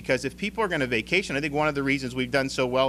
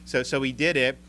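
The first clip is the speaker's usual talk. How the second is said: normal talking voice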